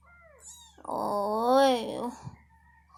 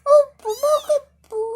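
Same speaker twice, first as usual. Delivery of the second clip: much higher, louder coaxing voice to the kittens; high pet talk; 14 semitones higher, 13 dB louder, slower than usual. Real, not acted. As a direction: ask the crying kittens what's wrong in a high sing-song.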